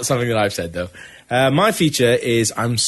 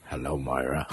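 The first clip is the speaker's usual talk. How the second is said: much lower and softer, a mock grave greeting; Patrick Stewart; 12 semitones lower, 13 dB quieter than usual. Retelling the film ending, imitating Patrick Stewart low and soft. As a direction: murmur it low like a resurrected mentor. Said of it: "Patrick Stewart comes into the body and he goes".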